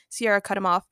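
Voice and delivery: plain reading voice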